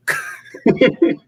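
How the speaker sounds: squealing laugh, way up high; real laugh breaking out high; high burst of laughter. Real, not acted. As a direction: crack up at your own joke. Big laugh after the joke.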